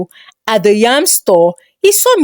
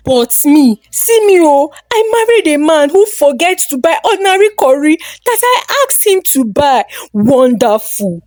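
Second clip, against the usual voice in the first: sarcastic mock lament; 5 semitones higher than usual. A narrator's reading. Wife mocking her husband over forgotten curry, high sarcastic wail. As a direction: rise up to a sarcastic peak, then drop flat.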